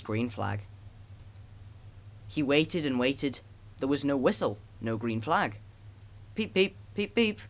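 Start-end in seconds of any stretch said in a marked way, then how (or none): 6.3-7.4 lightly voiced worried engine, high and slow; a hint of an impatient little engine calling; anxious little engine. A narrator's reading. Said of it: "Edward was getting anxious".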